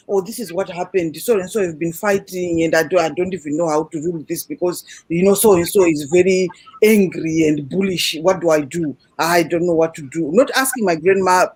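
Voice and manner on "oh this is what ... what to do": lower-pitched, lightly voiced old man at a loss; worried grandfather. Grandfather home from ruling, asking grandmother for help, lower and lost. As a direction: drop lower and sound unsure what to do. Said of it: "he'll come home and say".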